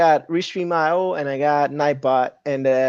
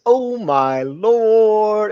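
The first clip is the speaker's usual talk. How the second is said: drawn-out, high read-aloud exclamation; mock shocked exclamation; 6 semitones higher, much slower than usual. Host reading Mariah's chat comment aloud, drawn-out mock shock. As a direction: stretch it out slowly in mock shock.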